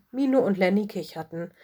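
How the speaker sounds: usual speaking voice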